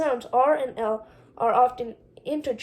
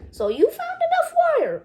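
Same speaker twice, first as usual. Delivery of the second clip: much higher put-on voice of the brother; brother's voice; 3 dB louder than usual. Ufulu teases his brother Condi in a much higher voice. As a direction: lift your voice high for Ufulu.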